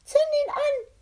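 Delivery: very high, loud yelled order; scream. Acted. Captain K ordering Kovac to light his ghost stick, a very high loud yell. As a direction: scream the command without hesitation. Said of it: "schrie er".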